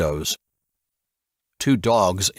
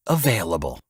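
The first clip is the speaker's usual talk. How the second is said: smooth, lusting; 2 semitones lower than usual. A narrator's reading. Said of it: "a smoother, almost lusting quality".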